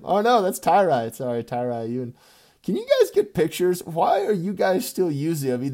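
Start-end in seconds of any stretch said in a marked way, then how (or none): 2.6-5.5 high, loud exasperated call-out; mock outrage at the group; exasperated shout. Real, not acted. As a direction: yell at the whole league. Scolding league about profile pictures.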